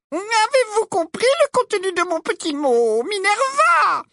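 very high, slow honeyed voice; syrupy false sweetness. A narrator's reading. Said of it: "d'un ton mielleux".

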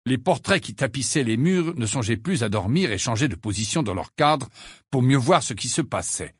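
ordinary delivery, nothing marked

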